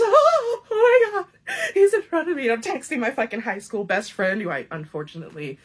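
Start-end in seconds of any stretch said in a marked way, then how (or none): 0.0-2.4 much higher and louder, breathless fangirling; starstruck squeal. Retold or imitated. Teen fan seeing her crush ahead, high breathless squeal. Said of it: "I'm just".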